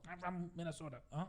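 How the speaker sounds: lightly put-on Minnesota accent, soft; a soft Minnesota accent on the hometown; mock Minnesota accent. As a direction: say it like a lazy northerner. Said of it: "Do Minnesota".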